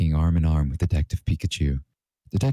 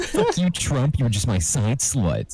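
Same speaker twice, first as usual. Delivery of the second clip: very annoyed; 8 semitones higher, slower than usual. A narrator's reading. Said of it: "said Sans, very annoyed".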